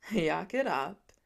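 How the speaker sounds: slow, grumbling low voice; a hint of a grumpy father; grousing dad. A narrator's reading. Dad grumbling at his snickering son, slow and low. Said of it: "Clint groused".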